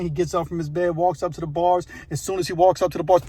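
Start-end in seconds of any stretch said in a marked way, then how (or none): none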